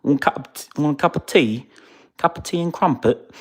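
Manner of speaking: high, punchy mock-British voice; hammy British, high and loud; exaggerated British. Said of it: "if I wanna be more British".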